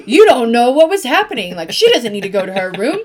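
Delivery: loud, heated protest; angry defending. Retold or imitated. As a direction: snap back at husband in defense of daughter. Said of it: "And then I get mad".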